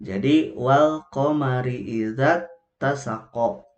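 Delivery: slow chant, idza tasaqo joined without lengthening; Quranic recitation. Shown as a demonstration. Teacher reciting the example verse for the mothers, slow chant. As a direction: recite it slowly and join the letters. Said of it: "Jadi digabungkan".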